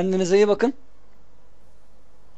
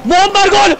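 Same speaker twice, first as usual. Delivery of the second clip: screaming football commentator; 9 semitones higher, 4 dB louder, slower than usual. Real, not acted. Screaming goal call.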